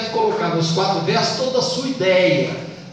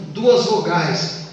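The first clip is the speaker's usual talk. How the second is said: ordinary talk, nothing marked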